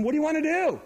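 normal talking voice

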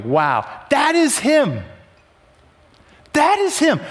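high, emphatic burst of awe; stretched-out, wowed outburst; amazed exclamation. Acted. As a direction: exclaim it in wonder, pitch leaping up. Acted amazement at sunrise and mountains.

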